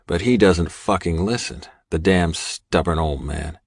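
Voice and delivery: normal talking voice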